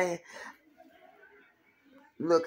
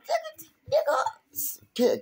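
ordinary talk

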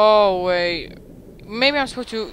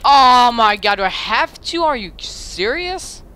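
exasperated; 3 semitones higher, 8 dB louder than usual. Real, not acted. Frustrated at a game task.

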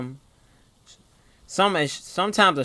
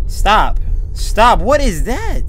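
higher, rising alarm; louder startled protest; 6 semitones higher, 9 dB louder, much slower than usual. Real, not acted. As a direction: beg it to stop, voice climbing. Alarmed by noise in game.

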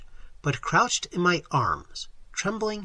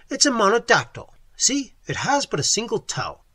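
higher, emphatic insistence; firm insistence; 7 semitones higher, 4 dB louder than usual. A narrator's reading. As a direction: press the argument firmly. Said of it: "I said firmly".

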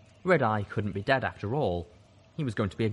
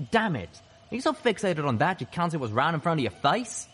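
high, heated scolding; exasperated outburst; 8 semitones higher, 4 dB louder than usual. A narrator's reading. Pleading with a fixated gunman in a standoff, exasperated outburst. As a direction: let frustration burst out, pitched up.

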